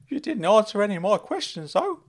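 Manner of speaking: high, loud eager uncle; Uncle Claude calling out. Acted. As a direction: squeal his name like a giddy fussing uncle. Uncle Claude voiced by narrator.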